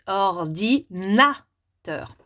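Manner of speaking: "ordinateur" slowly split, or, di, na, teur; découpage en syllabes. Shown as a demonstration. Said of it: "les petits carrés pour le mot". (« ordinateur » lentement découpé : or… di… na… teur)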